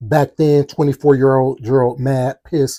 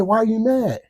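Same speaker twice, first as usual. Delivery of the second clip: put-on high-pitched child's question; imitated little boy; 8 semitones higher than usual. Little boy asking Gerald again, high imitated kid voice. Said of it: "He say".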